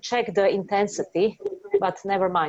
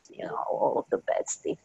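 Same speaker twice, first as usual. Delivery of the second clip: whispering; 6 dB quieter than usual. Real, not acted. Healer muttering during shame clearing.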